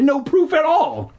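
very high, indignant outburst; shouted protest. Acted. Narrator arguing with the sister, high shouted protest. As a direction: drive the pitch way up in protest. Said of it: "I shouted".

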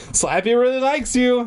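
high, forced-jokey tone; joking. Acted. Puppeteer trying to calm a trapped child, joking. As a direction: pitch it up to make light of things. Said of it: "I tried to make a joke of it".

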